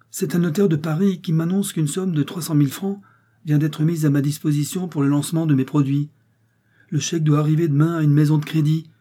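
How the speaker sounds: can't contain his joy; excited rush of news. A narrator's reading. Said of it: "repris subitement son attitude expansive et son entrain".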